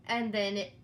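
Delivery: usual speaking voice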